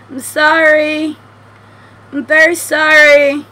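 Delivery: loud, high, slow sing-out apology; drawn-out apology. Pretend. Player apologises to the game creature killed for nothing, drawn-out apology. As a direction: call out sorry, stretching each word.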